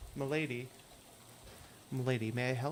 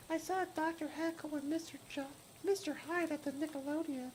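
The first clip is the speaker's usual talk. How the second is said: put-on much higher, thin ghostly girl voice; hitchhiker Lydia; 13 semitones higher, 4 dB quieter than usual. Hitchhiker asking the player for a ride, high ghostly voice. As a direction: play the strange girl in the rain begging a lift.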